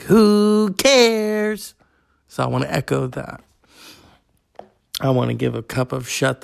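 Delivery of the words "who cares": high, loud, brassy dismissive "Who cares?"; imitating Elaine Stritch. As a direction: toss it off like a grand old Broadway diva, much higher and louder. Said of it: "you were like".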